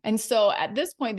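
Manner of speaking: ordinary talk, nothing marked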